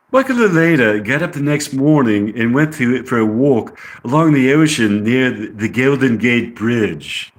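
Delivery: amateur English accent, reading a script. Shown as a demonstration. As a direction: read it in a put-on English accent. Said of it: "try to talk with an English accent".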